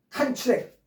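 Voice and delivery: 컨트랙 with a strong first syllable; stress on first syllable. Shown as a demonstration. Teacher giving the meaning "contract", 컨트랙 stressed on the first syllable. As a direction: say 컨트랙 as CON-tract, front-stressed. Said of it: "앞에 강세 있으면".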